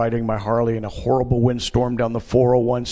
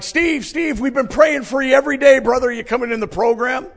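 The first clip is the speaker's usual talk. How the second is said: high-pitched, loud eager calling; imitating overjoyed friends; excited greeting; 12 semitones higher, 5 dB louder than usual. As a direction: gush it fast and high, like friends running up. Friends run up excitedly greeting him.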